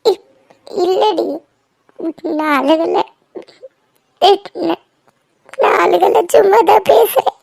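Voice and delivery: tearful, halting denial; crying. Acted. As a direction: stammer through tears while denying it. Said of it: "ஏண்டி அழுகுற?". (அழுகையை அடக்கி திக்கித் திக்கி)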